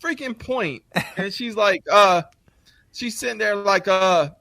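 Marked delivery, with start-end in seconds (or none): 0.0-0.8 pitched up, impatient; a high, impatient dad urging his daughter on; exasperated. Retold or imitated. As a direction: hurry her to the point in mock exasperation. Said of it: "I said".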